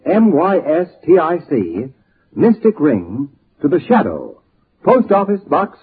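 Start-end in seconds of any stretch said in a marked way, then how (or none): none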